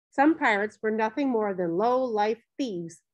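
ordinary delivery, nothing marked

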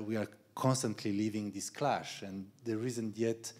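ordinary talk, nothing marked

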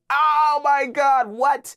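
high, loud, stretched-out gasp of disbelief; slow squeal of joy at the news; shocked delight. Retold or imitated. Hearing from his agent about the Dragon Ball offer, squealing in shock.

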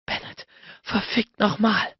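high, slow, moaned exclamation; moaned curse. A narrator's reading. Woman repeating a curse to her lover, moaning. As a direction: draw the curse out in a high moan.

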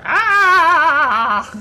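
long, loud, high scream; drawn-out mock wail; imitated scream. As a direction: scream as the little victim. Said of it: "He's like".

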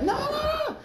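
very high squealed no no no; pleading no. Retold or imitated. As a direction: beg it not to happen. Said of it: "I'm like".

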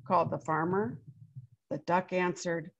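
put-on lively read-aloud voice for children; story narration. A narrator's reading. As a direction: tell it like a picture book to a class. Reads picture book aloud.

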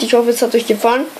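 ordinary talk

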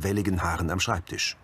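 normal talking voice

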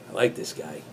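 usual speaking voice

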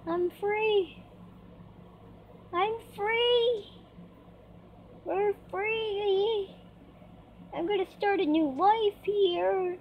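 high, jubilant cry of freedom; joyful. Real, not acted. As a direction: celebrate being set free, voice lifted. Celebrates release into the open world.

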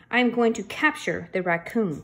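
ordinary delivery

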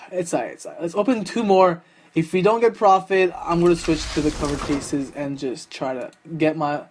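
ordinary talk

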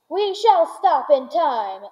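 a lightly voiced host calling out. A narrator's reading. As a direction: call back over the shoulder. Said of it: "called out Mister Wonka".

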